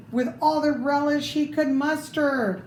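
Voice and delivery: usual speaking voice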